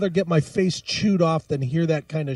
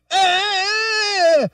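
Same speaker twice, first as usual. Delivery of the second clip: sudden high frustrated yell; frustrated shout; 12 semitones higher, 4 dB louder than usual. Acted. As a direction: blurt out an exasperated shriek. Outburst in rant.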